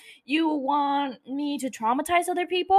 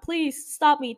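ordinary talk, nothing marked

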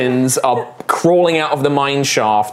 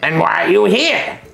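higher, condescending goblin voice, touches of threat; smart goblin; 4 semitones higher than usual. Acted. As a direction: sound like a clever goblin tired of fools. Goblin questions the party.

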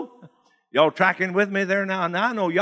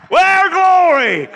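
high, slow, laughing cry of glory; joyful exclamation; 10 semitones higher, 6 dB louder, much slower than usual. Real, not acted. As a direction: burst out with delight at the testimony.

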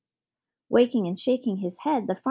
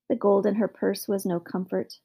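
usual speaking voice

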